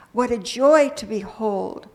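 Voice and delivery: ordinary talk, nothing marked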